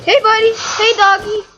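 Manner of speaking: high, loud coaxing to a dog; talking to a dog. Real, not acted. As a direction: call the dog over, high and sweet.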